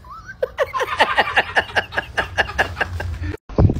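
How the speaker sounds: airy laughter after the punchline; laughing at his own joke; breathy laugh. Real, not acted.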